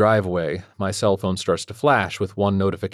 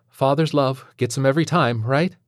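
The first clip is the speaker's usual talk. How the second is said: higher, playful sing-song nudge; teasing teacher; 7 semitones higher, 4 dB louder than usual. A narrator's reading. Teacher teasing the father, chirpy and higher. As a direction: tease him a little, all charm.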